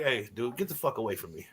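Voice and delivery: low, soft brush-off; offhand dismissal. Retold or imitated. Retelling his reply at the bank, low and offhand. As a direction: toss it off low, under your voice. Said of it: "I'm like".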